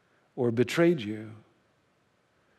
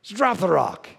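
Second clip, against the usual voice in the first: higher, loud urgent shout; yelling; 7 semitones higher, 4 dB louder than usual. Acted. Mary yells at the man going down, loud shout. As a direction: call out to the sinking man. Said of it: "and Mary yells".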